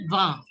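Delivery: "va" with the IN sounded as "a"; French pronunciation. Shown as a demonstration. Teacher explains French twenty to pupils, French pronunciation. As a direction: say "va" as one open syllable, final N unspoken. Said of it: "I N is pronounced as a".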